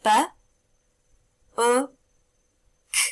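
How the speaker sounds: "B, U, K" as three separate sounds; sounding out words. Shown as a demonstration. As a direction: segment "B, U, K" sound by sound with pauses. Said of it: "let's see if you can all get it".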